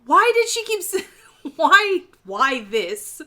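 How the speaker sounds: high, loud, baffled complaint; exasperated. Real, not acted. Host baffled by the queen's stalling, loudly exasperated. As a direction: protest in disbelief.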